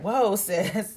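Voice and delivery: a surprised chuckle at the request; mock stylist startled with a laugh.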